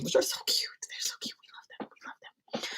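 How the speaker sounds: breathy whisper, barely voiced. Real, not acted. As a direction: whisper it like a cute secret. Gushing over double-decker buses.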